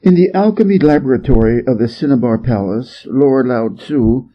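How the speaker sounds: ordinary delivery, nothing marked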